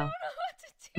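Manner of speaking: high, fast giggling words; laughing helplessly. Real, not acted. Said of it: "laughing fit".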